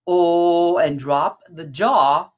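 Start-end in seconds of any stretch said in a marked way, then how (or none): 0.1-0.8 "oh" lower with the jaw dropped; saying oh. Shown as a demonstration. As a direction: round low "oh" with dropped jaw. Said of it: "we move to the low note".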